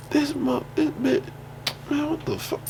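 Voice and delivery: high, hushed mock bafflement; soft, high-pitched incredulous aside; mimicked disbelief. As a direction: imitate someone stunned, softly to himself in a high pitch. Voices the baffled man's reaction.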